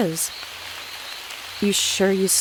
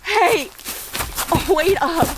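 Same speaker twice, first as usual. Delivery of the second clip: calling out; 9 semitones higher than usual. Acted. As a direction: shout to make him stop. Calling after companion ahead.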